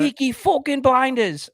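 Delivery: slow, high British accent. Shown as a demonstration. Accent challenge, a hammed-up slow British accent. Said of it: "I said it with a oh".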